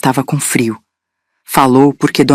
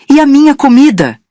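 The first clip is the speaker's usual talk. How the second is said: much higher, loud demanding shriek; shouting; 11 semitones higher, 4 dB louder than usual. Acted. Mother-in-law yelling from the table for more food, loud. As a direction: yell it from the table, indignant. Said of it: "Dona Norma gritou da mesa".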